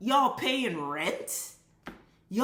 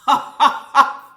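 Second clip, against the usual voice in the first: loud laugh; 6 semitones higher, 12 dB louder than usual. Real, not acted. Laughs at own anger.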